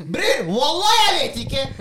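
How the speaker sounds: loud, high, slow slang outburst; kebabnorsk kid. Retold or imitated. Showing how kids would say it in kebabnorsk, loud and high. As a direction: belt it out like a street kid swearing he doesn't know. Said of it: "gjort ting lettere for kids".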